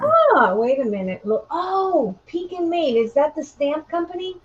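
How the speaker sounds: a high, surprised oh, then realising; sudden recognition. Real, not acted. Reading a viewer comment, suddenly places the company, surprised. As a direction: catch the name as it clicks.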